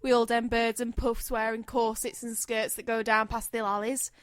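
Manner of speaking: light put-on Cockney accent. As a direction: read it as a hammed-up London Cockney. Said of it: "You have to do the accent".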